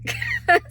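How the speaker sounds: short high laugh; a quick high giggle at her own joke; high burst of laughter. Real, not acted.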